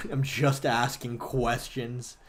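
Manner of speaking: slow, low mocking mimic; sarcastic slow parody; mocking quote. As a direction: drag it out like a smug dodger. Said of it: "You are doing the whole just asking questions thing".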